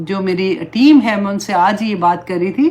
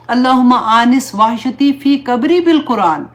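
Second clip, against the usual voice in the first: prayer recitation; 3 semitones higher, 3 dB louder, slower than usual. Acted. Recites a short du'a.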